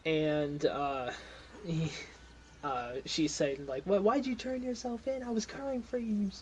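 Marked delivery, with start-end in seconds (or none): 3.8-6.3 high scolding voice; scolding cousin. Acted. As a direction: chew him out, pitched high. Molly scolding her cousin.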